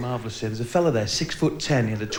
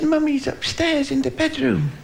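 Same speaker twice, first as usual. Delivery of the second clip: lightly voiced little girl; 12 semitones higher, slower than usual. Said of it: "She said".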